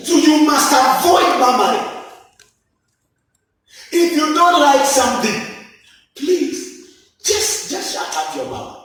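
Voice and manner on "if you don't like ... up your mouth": ordinary talk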